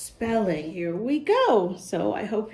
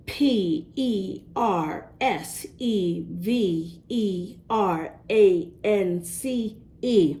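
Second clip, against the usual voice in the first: spelling out slowly; slower than usual. Acted. Stacy spells her favorite word.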